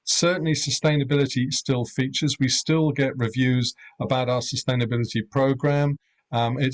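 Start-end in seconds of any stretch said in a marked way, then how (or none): none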